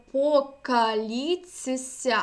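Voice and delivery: покаліцися drawn out syllable by syllable, very slowly; ts-s assimilation. Shown as a demonstration. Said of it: "«цси»". (покаліцися повільно, по складах, з ць-сь)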